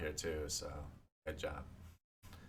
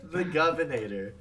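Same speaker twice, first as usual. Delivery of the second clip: laughing; 8 semitones higher, 4 dB louder, slower than usual. Real, not acted.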